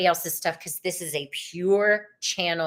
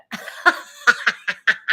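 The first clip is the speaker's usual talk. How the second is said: bursting into a real laugh; airy delighted laugh; laughter; 5 dB louder than usual. Real, not acted. Laughs at her own gut reading.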